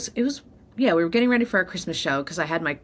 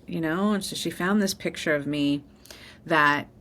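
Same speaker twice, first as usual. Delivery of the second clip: ordinary delivery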